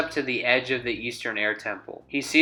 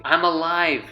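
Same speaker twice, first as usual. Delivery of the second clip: loud, high joyful yell; 5 semitones higher, 4 dB louder than usual. A narrator's reading. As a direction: shout it out in relief. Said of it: "yells Lee".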